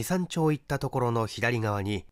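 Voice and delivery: usual speaking voice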